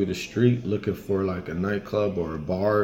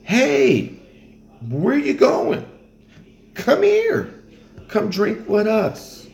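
very high, loud calling after someone, slowing at the end; eager local calling out; 13 semitones higher, 3 dB louder, slower than usual. Retold or imitated. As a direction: squeal at a passer-by to come drink. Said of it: "No one's gonna be like".

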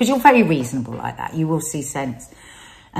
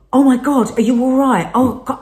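higher, louder mock panic; 6 semitones higher, 5 dB louder than usual. Wife hearing husband met someone, high alarm. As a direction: gasp it out in alarm. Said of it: "I went into this total panic".

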